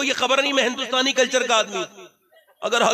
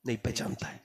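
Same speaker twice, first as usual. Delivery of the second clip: whispered repeat; 10 semitones lower, 13 dB quieter than usual. Real, not acted. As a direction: drop to a near whisper. Whispered aside.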